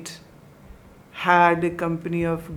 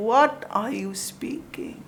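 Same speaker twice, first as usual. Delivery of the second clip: tense whisper; 3 semitones higher, 3 dB quieter, slower than usual. Retold or imitated. Upset father confronts daughter.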